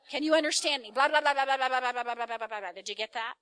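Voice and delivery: very loud rapid blah-blah nagging, mimicked; mock rant imitation. Husband mimicking how wife sounds to him, loud mock rant. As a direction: blast it out like an unstoppable tirade, then demand a reply. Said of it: "this is how it sounds to me".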